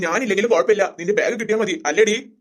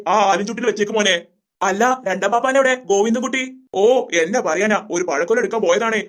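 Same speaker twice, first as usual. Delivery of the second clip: ordinary delivery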